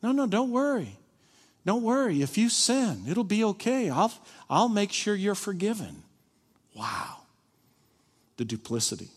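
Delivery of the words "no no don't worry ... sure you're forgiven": high-pitched, mock-reassuring promise; playing God. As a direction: hand out forgiveness as if you were God. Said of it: "start talking like you're God himself".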